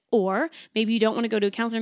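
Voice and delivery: ordinary talk, nothing marked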